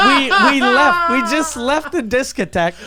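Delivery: high and loud through laughter; laughing. Real, not acted. Recounting fleeing the bar bill, laughing high and loud. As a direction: laugh as you tell the escape.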